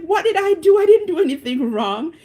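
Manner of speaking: high, loud mock innocence; acted protest. Driver who just arrived protests the ticket, high and loud. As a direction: plead innocence with pitch jumping up.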